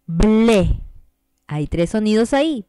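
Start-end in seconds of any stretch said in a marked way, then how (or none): 0.1-0.8 ble said as one clear beat; ble, three sounds in one push of voice; syllable demo. Shown as a demonstration. Teacher giving students a syllable example, crisp beat. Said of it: "Otro ejemplo es".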